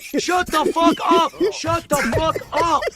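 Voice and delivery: high, loud, enraged yelling; furious shout. Real, not acted. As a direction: scream it at the screen in frustration. Raging at boring game dialogue.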